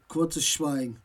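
plain reading voice

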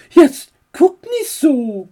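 aunt's voice. Acted. Aunt scolding Max's stare, high voice. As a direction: chide him mildly, aunt's voice.

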